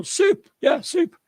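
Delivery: hammed-up tailor voice; mock tailor. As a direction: imitate the tailor agreeing. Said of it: "And they go".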